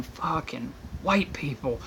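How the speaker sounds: slow, weary under-the-breath grumble; muttered curse. Retold or imitated. Exasperated muttered complaint.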